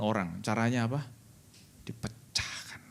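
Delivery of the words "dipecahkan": breathy stage whisper of the answer; hushed, secret-telling whisper. Acted. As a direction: drop to a whisper to reveal it. Answers his own question.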